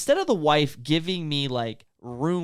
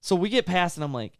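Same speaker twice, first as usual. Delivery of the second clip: usual speaking voice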